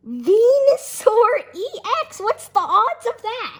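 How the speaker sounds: loud, high shout of disbelief; amazed excitement. Real, not acted. Kid pulls a rare Venusaur card, real excited shouting. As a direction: shout it, stunned by your luck.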